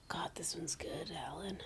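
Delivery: breathy whispered aside. Real, not acted. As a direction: whisper it so nobody else hears. Aside while reading the answer.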